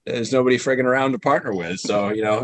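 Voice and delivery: ordinary talk, nothing marked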